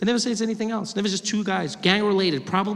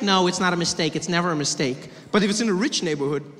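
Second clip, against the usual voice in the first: ordinary talk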